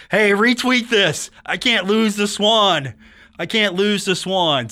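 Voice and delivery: hammed-up plea not to lose the Swan; mock pleading rival. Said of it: "On the phone with all his comrades".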